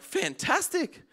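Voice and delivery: drawn-out, high, slow "fantastic"; sing-song relief; mock delight. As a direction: stretch it out, pleased with yourself. Pleased to see the driver.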